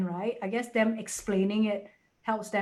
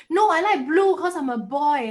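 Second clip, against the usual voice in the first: imitated little boy; 6 semitones higher, 7 dB louder than usual. Acting out small child's answer.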